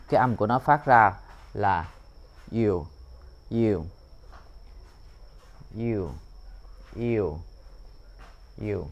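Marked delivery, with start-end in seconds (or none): 2.5-8.9 "you" with a clear y-u glide; model you sound. Shown as a demonstration. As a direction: repeat "you" slowly as a model. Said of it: "chữ J được phát âm là". (đọc mẫu âm "you" rõ ràng)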